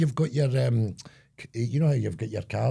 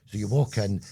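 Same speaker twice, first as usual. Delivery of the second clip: ordinary delivery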